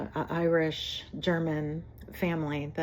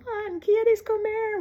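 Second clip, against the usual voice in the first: high, slow singing-out call in Spanish; imitated yelling; slower than usual. Spanish neighbour yelling to her son, high mock call. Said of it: "She was always yelling".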